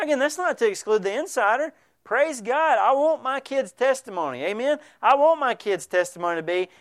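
ordinary delivery

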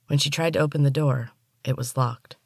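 ordinary delivery, nothing marked

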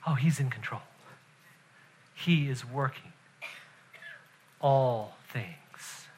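very soft, low and deliberate; quiet, weighty pulpit emphasis; hushed solemn preaching. Real, not acted. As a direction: let each phrase sink in between long pauses.

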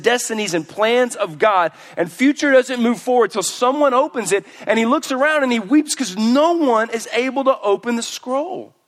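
loud, high impassioned preaching. Acted. Preacher building up to the congregation, impassioned.

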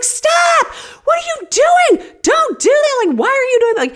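high, loud frantic shout. Retold or imitated. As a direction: scream at him to stop. Wife shouting at husband.

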